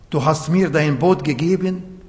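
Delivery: ordinary delivery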